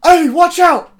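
high, loud hammed-up warning; mock heroic cry of watch out; dramatic warning. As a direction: overact the warning, pitch way up. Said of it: "he's very dramatically".

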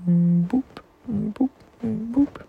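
sweet cooing boop noises; playful boop sounds. Real, not acted. Playful kisses to child.

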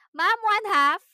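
loud whiny student voice; mimicking students. Teacher imitating pestering students, loud mock whine.

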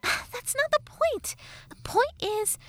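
high-pitched sassy fairy; Tattle fairy voice. Acted. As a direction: play a cheeky fairy girl, high.

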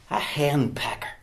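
hushed confiding whisper; secretive aside to the congregation; whispered reveal. Acted. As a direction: lean in and whisper the answer. Hushed reveal of word meaning.

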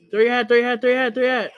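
loud, high, repeated commands; urgent shouting. Real, not acted. Player urging Mario to throw the hat, urgent shouting.

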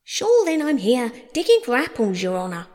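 voiced servant answering from afar; unfamiliar servant voice. A narrator's reading. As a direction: call back respectfully to the master. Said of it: "a voice she had never heard before".

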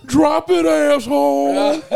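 loud, slow, drawn-out threat; tough-cop movie line. Retold or imitated. Friend imagines a cop line, loud and drawn out. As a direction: growl it like an action hero. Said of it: "I could just see".

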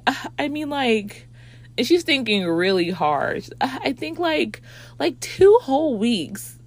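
high, hesitant ditzy voice; clueless friend. Retold or imitated. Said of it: "And she's like".

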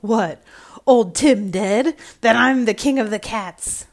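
loud triumphant cat cry; shrieked. A narrator's reading. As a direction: burst out as the new king. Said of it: "Tom shrieked out".

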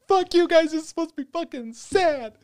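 very high, loud mock-crying yell; tearful mock outrage. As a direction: wail at them as if crying and indignant. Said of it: "I'm fucking crying".

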